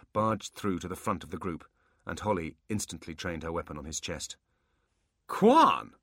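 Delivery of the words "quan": loud, high shout of amazement; amazed. Acted. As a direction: call out the name in shock. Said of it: "obviously amazed".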